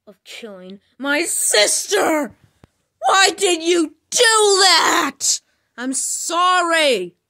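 slow, loud, high shouting at the killer; angry accusation. Acted. Accusing Norman Bates of killing her sister, slow loud shout. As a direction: yell the accusation, drawn out.

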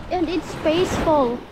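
usual speaking voice

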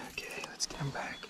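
soft unvoiced whisper. Real, not acted. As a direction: whisper it to yourself. Quiet release of fish.

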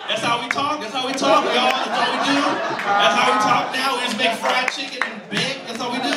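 loud, mocking repetition; sarcastic rant. Comic mocking the ad to the crowd, loud sarcastic.

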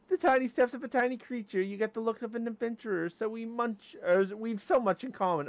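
high-pitched little critter voice; high, chirpy creature role; friendly tiny creature. Acted. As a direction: read it as a small creature greeting an adventurer. Reads creature's game dialogue.

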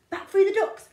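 hurried. Acted. Running away fast.

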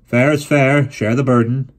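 loud hearty fatherly pronouncement; a jovial father declaring the rule; firm father. Acted. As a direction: lay down the rule. Father continues.

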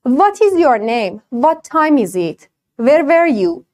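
"What" and "were" said with a V, teeth on lip; Persian-style V sound for "What is your name"; wrong W as V. Shown as a demonstration. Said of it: "دبلیو اصلاً نباید دندونت به لبت بخوره". (دبلیو با صدای و، دندان روی لب)

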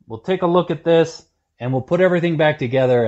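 normal talking voice

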